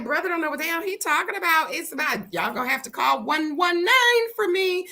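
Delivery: high, fast panicked wail; high frantic plea. Retold or imitated. Panicking as the lizard falls.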